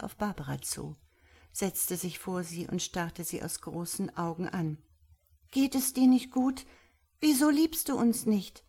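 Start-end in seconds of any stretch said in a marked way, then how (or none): 5.5-8.6 high, innocent little creature's voice; guileless innocence. A narrator's reading. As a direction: ask artlessly, pitched high. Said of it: "In ihrer Stimme lag die ganze Arglosigkeit". (arglos fragend, hohe kindliche Stimme)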